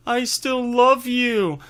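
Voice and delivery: high, slow lovesick voice; tender girlfriend. Retold or imitated. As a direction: coo it to the walrus. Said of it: "she's like".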